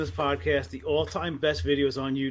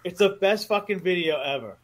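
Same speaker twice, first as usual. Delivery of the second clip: ordinary delivery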